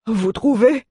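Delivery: high, grumbling doubtful question; grumbling dwarf. Acted. Dwarf grumbles his doubt to Galiad. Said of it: "grogna le nain".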